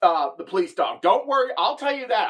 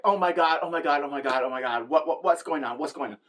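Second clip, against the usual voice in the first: panicked; 6 semitones lower than usual. Retold or imitated. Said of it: "I'm freaking out".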